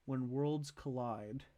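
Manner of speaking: normal talking voice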